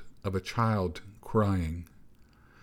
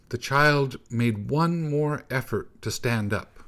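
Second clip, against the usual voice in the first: ordinary delivery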